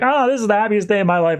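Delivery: retold giddy protest, high and quick; mildly exasperated gushing. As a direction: act out a bride brushing off a warning, quickly. Bride brushes off friend's warning.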